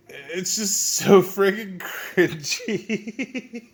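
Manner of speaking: slow, high, breaking into giggles; cracking up. Real, not acted. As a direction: drag it out until it dissolves into laughter. Cringing at the book, cracks up.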